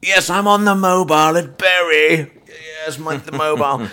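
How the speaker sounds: loud, high shout over a bad line; shouting into a phone. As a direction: yell into the mobile so they hear you. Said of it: "I'm shouting into this thing".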